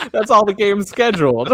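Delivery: high, loud, through real laughter; laughing. Real, not acted. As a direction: barely get it out for laughing. Cracking up at own remark.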